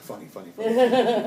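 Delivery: high, loud, laughing mock delight; a laughing squeal of mock excitement; laughing mock glee. Mocking the cinematically funny option.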